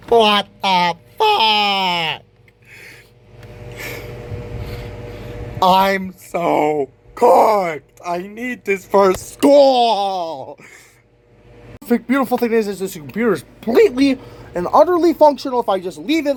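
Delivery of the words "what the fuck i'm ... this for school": low, very slow, crying despair. Real, not acted. Student lamenting his broken laptop before school, despairing. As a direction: drag the words out, defeated.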